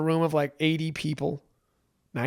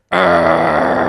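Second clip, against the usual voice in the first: a loud, snarling growl of rage; angry growl; 10 semitones lower, 14 dB louder than usual. Retold or imitated. Growling at the woman who looks at her lovingly, furious. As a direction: growl at her like a cornered animal. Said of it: "And I'm like".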